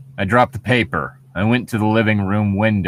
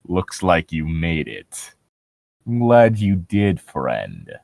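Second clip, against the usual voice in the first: creepy voice; 5 semitones lower, much slower than usual. Acted. Narrator voices mysterious stranger.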